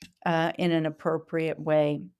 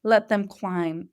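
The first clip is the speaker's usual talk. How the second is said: ordinary talk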